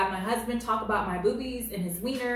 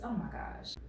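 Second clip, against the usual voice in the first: ordinary delivery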